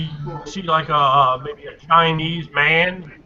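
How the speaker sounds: ordinary talk, nothing marked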